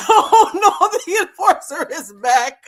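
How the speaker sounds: high, loud laughing lament; giggling mock horror. Real, not acted. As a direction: laugh through the mock dread. Laughing at the enforcer's return.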